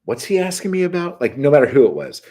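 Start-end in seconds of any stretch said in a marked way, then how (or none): 0.1-1.2 high-pitched baffled question; imitating a confused man asking his helper; Harry Dean. Harry Dean turning to his driver, puzzled. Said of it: "he would say".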